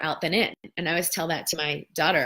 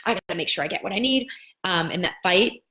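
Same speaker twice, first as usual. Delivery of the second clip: embattled mindset; 4 semitones higher, 3 dB louder than usual. Retold or imitated. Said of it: "your entire mental processing".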